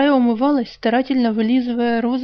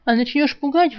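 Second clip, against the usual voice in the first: plain reading voice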